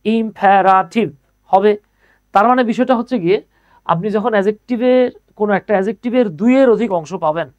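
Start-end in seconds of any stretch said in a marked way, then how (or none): 0.0-1.1 "ইম্পেরাটিভ" very slowly as im-pe-ra-tiv; "ইম্পেরাটিভ" drawn out with gaps between syllables; split into syllables. Shown as a demonstration. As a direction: count out each part of "ইম্পেরাটিভ". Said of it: "ভাগ করেন তো কয়টা আছে".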